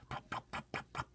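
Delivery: mimicked squelchy plops; soft plop sounds. Narrator sounding out the hailstones' squelch. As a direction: plop them softly. Imitating hail hits.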